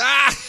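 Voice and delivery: high-pitched yelp breaking into laughter; real high squealed laugh. Real, not acted. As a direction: burst out laughing at the tease. Teased as one of "you whiteys.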